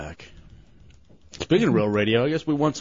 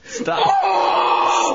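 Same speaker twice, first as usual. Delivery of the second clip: laughing shriek. Real, not acted. Host shrieks amid chaos.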